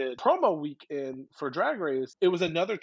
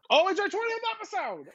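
excited. Real, not acted. Celebrating milestone.

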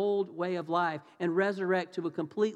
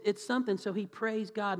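usual speaking voice

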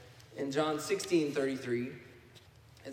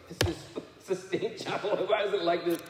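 higher, mock-exasperated complaint; playfully voiced indignation; retold frustration; 4 semitones higher, 3 dB louder than usual. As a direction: imitate an annoyed tourist, half laughing. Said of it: "she was frustrated and angry".

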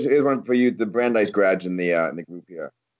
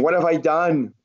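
higher-pitched mock lament of a mother; 7 semitones higher, slower than usual. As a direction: wail it lightly as a distressed mother. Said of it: "with your mother standing by your side".

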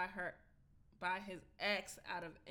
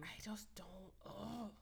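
whisper; 10 dB quieter, slower than usual. Real, not acted. Exasperated whisper.

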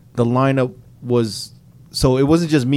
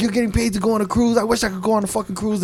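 much higher, mocking whiny rant; exasperated manager; 9 semitones higher, faster than usual. Retelling how his manager scolded him over the cruise, high exasperated mock rant.

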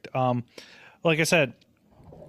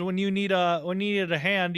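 normal talking voice

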